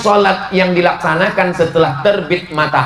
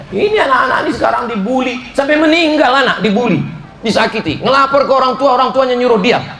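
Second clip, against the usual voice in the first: raised and higher, quickening with outrage; impassioned outcry; 8 semitones higher, faster than usual. Real, not acted. Preacher to the congregation about bullied children, impassioned outcry. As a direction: rail against the bullying with rising passion.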